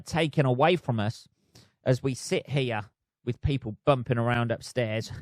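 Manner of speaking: ordinary talk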